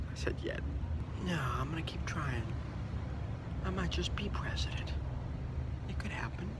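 unvoiced whispered vow. Real, not acted. Candidate whispers he won't give up, hushed. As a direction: breathe it like a secret promise.

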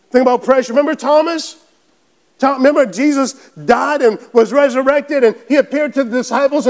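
ordinary talk

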